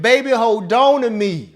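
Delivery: loud, drawn-out plea to the market; mock scream. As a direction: cry out slowly like a lovesick singer. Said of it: "I'm screaming".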